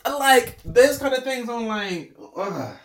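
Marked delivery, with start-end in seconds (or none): none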